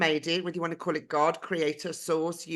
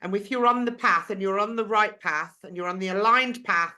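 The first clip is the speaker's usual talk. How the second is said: usual speaking voice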